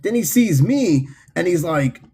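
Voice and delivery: usual speaking voice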